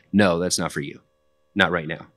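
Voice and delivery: ordinary delivery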